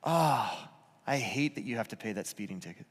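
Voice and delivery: sympathetic parent. Shown as a demonstration. Parent to the teen with a speeding ticket, sympathetic. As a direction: soften it, truly sorry for them. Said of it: "Looks like this".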